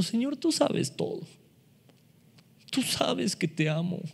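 high, soft, saddened plea; Peter, grieved by the third question, softly pleading. Acted. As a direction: answer with hurt, quietly. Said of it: "la tercera vez se entristeció".

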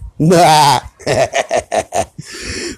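a warm burst of real laughter. Real, not acted. Podcaster laughing at his own Pictionary joke, real laughter.

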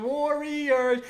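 high, drawn-out imitated holler; yelling. Imitating a drunk friend at the game, yelling. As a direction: mimic a drunk fan's long team chant. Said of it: "he just starts yelling".

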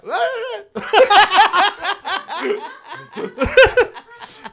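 long shrieking burst of laughter; long high laugh. Real, not acted. Friend cracking up at the mute Cuban act, laughing long and high.

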